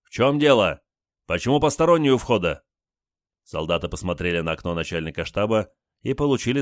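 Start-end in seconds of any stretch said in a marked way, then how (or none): 0.0-2.6 high, loud commanding bark; menacing shout. Acted. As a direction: yell down like an angry officer. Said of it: "Олег грозно крикнул".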